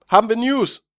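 high, loud playful ask; playful question. Real, not acted. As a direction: ask it like a show host.

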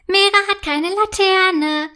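put-on a little girl's loud sneering sing-song; mocking holler. A narrator's reading. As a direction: bawl it out to mock her friend. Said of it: "grölt Marie und lacht".

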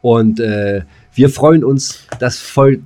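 ordinary talk

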